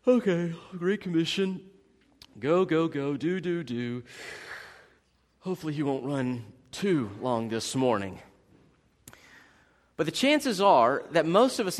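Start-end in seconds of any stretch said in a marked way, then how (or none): 0.1-8.2 softer, bored mock listener, trailing off. Preacher mimics a bored congregant, softly mocking. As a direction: mimic a bored churchgoer tuning out. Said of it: "some of you are already kinda going".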